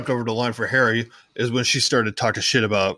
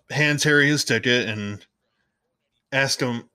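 usual speaking voice

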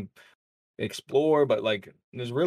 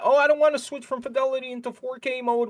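mocking whiny voice; 12 semitones higher, 4 dB louder than usual. Mocking console players' complaints.

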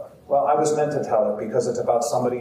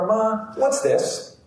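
put-on higher child's voice, eager question; curious little boy; 9 semitones higher, much slower than usual. Young boy asking his mother, curious and high. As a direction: sound like a five-year-old finding treasure.

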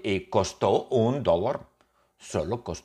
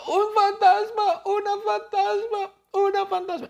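much higher panicked shrieking cry; frightened scream. Acted. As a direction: scream it like you've just seen a ghost. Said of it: "gritó". (grito aterrado y agudo)

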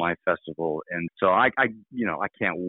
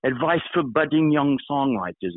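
put-on terrible English accent, pitched higher; 6 semitones higher than usual. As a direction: badly imitate an English rock star. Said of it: "It's gonna be terrible English accent".

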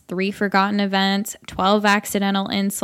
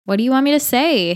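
sharp, raised snap; snapped; 6 semitones higher, 4 dB louder than usual. A narrator's reading. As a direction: snap back at him. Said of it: "Haley snapped".

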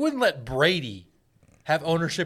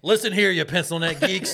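loud, laughing wrestler snarl; wrestling promo; 5 semitones higher, 5 dB louder than usual. Acted. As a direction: growl it like a heel wrestler. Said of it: "Hard Times promo".

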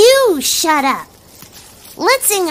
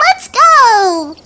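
ordinary talk

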